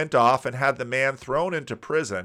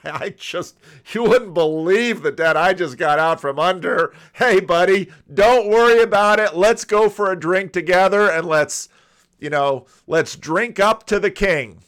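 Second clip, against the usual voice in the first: loud, hearty, carefree pal brushing off a debt; big friendly buddy voice, louder and higher; jovial buddy; 5 semitones higher, 7 dB louder than usual. Acted. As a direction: slap him on the back and wave the debt away. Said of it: "he could say".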